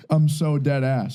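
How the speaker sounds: ordinary delivery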